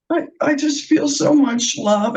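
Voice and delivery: I just feel so much love, said high and tearful; crying. Real, not acted. Telling the circle she feels love, tearfully. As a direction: let the love come out through tears. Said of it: "I want to apologize for crying".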